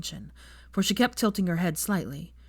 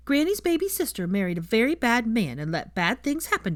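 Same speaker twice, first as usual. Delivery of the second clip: put-on child voice; 6 semitones higher than usual. A narrator's reading.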